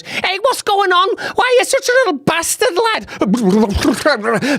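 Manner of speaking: high-pitched working-class heckler, drawn out at the end. Acted. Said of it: "a real working class person comes up".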